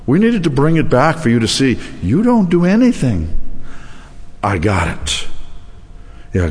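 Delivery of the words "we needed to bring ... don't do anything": high-pitched voice of God; God answering. Retold or imitated. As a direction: gently correct him in a higher voice. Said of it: "talking to God".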